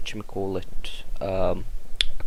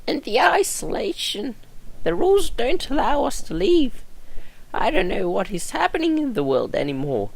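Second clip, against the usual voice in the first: put-on a frail old woman remembering; old nurse voice; 8 semitones higher, 3 dB louder than usual.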